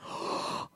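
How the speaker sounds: acted gasp of shock. Crowd seeing the boy seem dead, shocked gasp.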